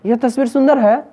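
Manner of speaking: high, astonished exclamation; surprise. Shown as a demonstration. Teacher asking class to hear wonder, astonished. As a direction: exclaim in amazement, pitch falling at the end. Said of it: "आश्चर्य हो रहा".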